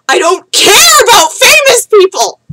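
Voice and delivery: high, slow, loud tearful scream; crying shout. Retold or imitated. Yelling at her cousin on the platform, crying shout. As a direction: scream it through tears, past caring. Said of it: "and I shout".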